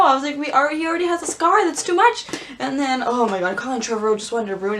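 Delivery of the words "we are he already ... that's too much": high-pitched, distressed fan complaint; exaggerated upset whine; dismayed protest. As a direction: protest with rising pitch, half joking. Acting own dismayed reaction.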